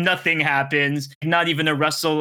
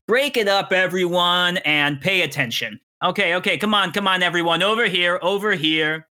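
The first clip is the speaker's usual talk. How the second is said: a somewhat higher, strong call over the crowd; teacher's shout; 4 semitones higher than usual. Acted. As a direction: round up the noisy class. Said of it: "strong, clear voice shout above the talking".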